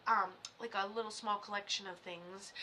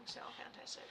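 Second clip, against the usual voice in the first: whisper; 13 dB quieter than usual. Real, not acted. Whispered aside.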